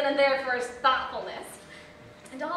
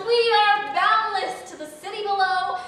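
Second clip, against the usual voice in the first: mock exultant yell; 6 semitones higher, 9 dB louder than usual. Date yelling from a rooftop to the city, mock-exultant. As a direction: proclaim it grandly to a whole city. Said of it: "and yells".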